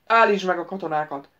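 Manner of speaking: loud, gruff order; growled command. Acted. The prince growls at the wizard: loud gruff command. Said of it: "mordult Szajon".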